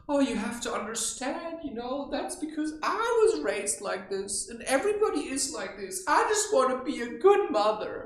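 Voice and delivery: high, pleading mock-mother voice; self-justifying mother. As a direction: plead your case as an aggrieved mother. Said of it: "then you can say".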